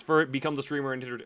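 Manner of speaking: ordinary delivery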